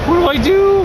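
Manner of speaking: high, far, panicky little voice; panicked high squeak. Retold or imitated. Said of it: "she was like".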